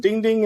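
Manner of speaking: high sung ding ding like a bell; playful high bell imitation; mimicked bell. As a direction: chime it out like an idea landing. Idea clicks, mimics a bell.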